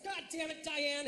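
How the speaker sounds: mock distressed. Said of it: "say that phrase in distress".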